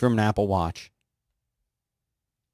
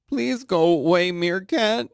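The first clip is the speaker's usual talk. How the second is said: higher pleading voice; mock pleading; 7 semitones higher, slower than usual. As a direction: beg it to leave, hammed-up. Pleading with Meerkat app.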